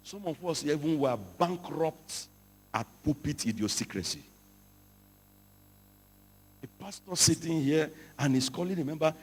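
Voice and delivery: usual speaking voice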